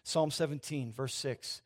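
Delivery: normal talking voice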